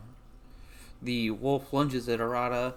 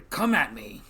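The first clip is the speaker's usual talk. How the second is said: loud, raised-pitch taunting shout; yelled challenge; 5 semitones higher, 8 dB louder than usual. Acted. Game master echoes the swordsman's yell at wolves, loud challenge. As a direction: call it out like a dare to attackers. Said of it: "He, like, yells at them".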